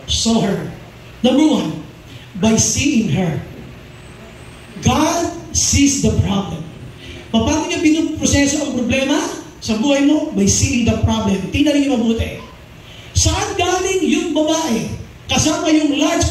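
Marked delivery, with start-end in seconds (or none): none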